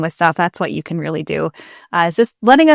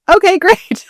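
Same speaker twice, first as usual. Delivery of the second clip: high, louder okay great through a laugh; mock-thrilled, pitched way up; delighted okay; 12 semitones higher, 6 dB louder than usual. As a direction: play it up as thrilled. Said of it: "I was like".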